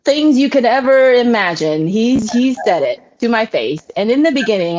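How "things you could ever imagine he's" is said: usual speaking voice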